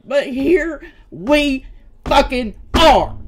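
loud, slow, bitter "here we fucking are"; fed up and furious; bitter anger. Real, not acted. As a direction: lean hard on every word in resentment. Angry at being forced publicly.

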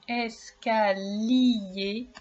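"escalier" drawn out slowly, in separate syllables. Shown as a demonstration. Teacher warning a pupil to listen for lier, "escalier" slowly syllable by syllable. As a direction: stretch "escalier" slowly so each sound is heard. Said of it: "Alors attention".

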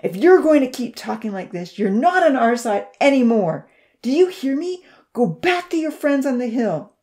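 loud furious outburst; angry shout. A narrator's reading. Odin silencing Poncy, loud angry shout. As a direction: shout him down.

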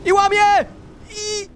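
very high breathless-paced amazement; frantic excitement. Real, not acted. Player breaks the wave with the sponge, high frantic excitement. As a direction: shriek the play as it happens, pitch way up.

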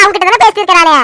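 ordinary talk, nothing marked

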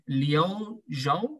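ordinary delivery

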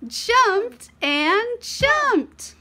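high, very slow, gasping excitement; a delighted storytime build, high and slow; excited. Acted. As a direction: stretch out the jumping with glee. Child jumping on bed, storytime.

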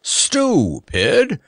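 high, insistent knightly call; loud repeated order. A narrator's reading. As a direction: call each word out high. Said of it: "he repeated loudly".

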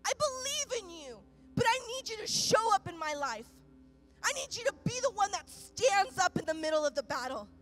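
loud, high, impassioned prayer; rising passionate preacher's cry; full-force preaching. Acted. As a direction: pour it out at full force to God. Preacher cries out to God.

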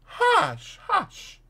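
very high, urgent hushing; alarmed hushing. A narrator's reading. Doctor silences Fetz on the stairs, exclaiming in alarm. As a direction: exclaim it, anxious to silence him. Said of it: "exclaimed the doctor".

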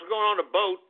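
ordinary delivery

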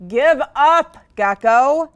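loud, high taunting shout; rising, punched-out call. A narrator's reading. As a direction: shout it like a jeering crowd. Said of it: "All of the animals shouted".

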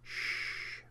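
soft whispered shush; hushing whisper. A narrator's reading. The horse hushes Rowan with a whispered shush. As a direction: hush her gently, barely voiced. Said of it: "a quiet voice said".

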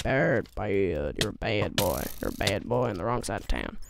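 deep, quiet put-on voice; mock tough guy. Gamer scolding a monster as a bad boy, mock deep voice.

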